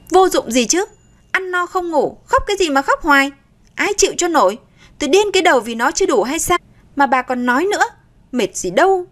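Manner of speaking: high, fast, grumbling retort; irritated retort. Acted. Daughter-in-law snapping back at her scolding mother-in-law, irritated. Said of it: "Nga nhăn nhó đáp".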